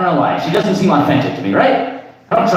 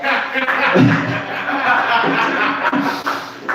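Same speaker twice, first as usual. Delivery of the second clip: airy laugh; 9 semitones higher, 4 dB quieter than usual. Real, not acted. Laughing at own joke.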